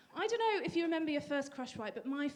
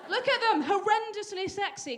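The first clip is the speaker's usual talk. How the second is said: mock boast; 5 semitones higher, 8 dB louder than usual. Showing off ankles.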